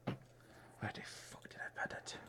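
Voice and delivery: hushed whisper to himself. Real, not acted. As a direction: whisper it under your breath. Muttering to self, searching.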